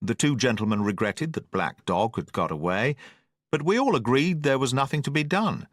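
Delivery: ordinary delivery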